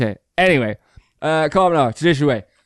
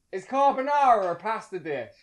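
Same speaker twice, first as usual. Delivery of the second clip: high and softer, sent away from the listener; calling a question; 6 semitones higher, 7 dB quieter than usual. Real, not acted. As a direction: call it through to your mum. Asking mum in another room.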